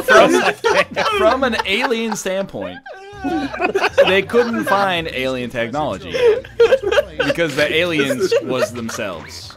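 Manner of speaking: words breaking into giggles; laughing while quoting. Real, not acted. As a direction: quote it back through laughter. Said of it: "I'm gonna say what you just said back".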